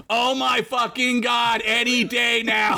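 high, slow exasperated yell; exasperated shout. Acted. Stoner Fred complains loudly.

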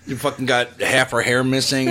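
normal talking voice

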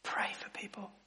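breathy hushed whisper. Acted. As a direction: whisper it as a secret urging. Pastor drops to a whisper.

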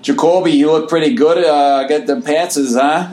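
a light put-on Boston accent, a bit like Fargo; mock Boston accent. Co-host teasing Boston media in a mild mock Boston accent. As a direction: hams up a Boston sports fan, half-hearted. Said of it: "Is that supposed to be a Boston accent?".

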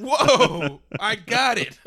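loud, high mock "whoa"; hammed-up shock; mock amazed.

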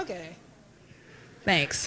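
low, soft, underplayed reply; awkward thanks. Birthday guest answering yet another greeter, awkward. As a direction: say it small, as if overwhelmed by attention.

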